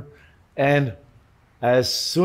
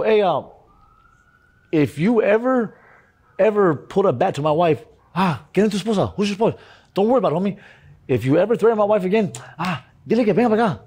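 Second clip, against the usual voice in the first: high-pitched, heated warning; acted threat; 8 semitones higher, faster than usual. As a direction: re-enact warning a man off your wife. Retelling his threat to the man.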